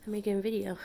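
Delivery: normal talking voice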